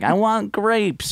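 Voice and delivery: put-on toddler voice. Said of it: "Then she's like".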